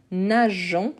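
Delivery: "nageons" slowly, with zh for the g; soft j in nageons. Shown as a demonstration. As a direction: stretch "nageons" as na-zhon. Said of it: "les sons de j".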